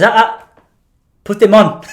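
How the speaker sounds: loud, high mock grandpa order; demanding grandfather. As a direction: cut in as a bossy old husband.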